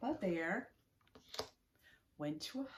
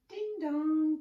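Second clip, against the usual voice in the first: doorbell ding dong; 9 semitones higher, 10 dB louder than usual. Acted. Doorbell sound in story.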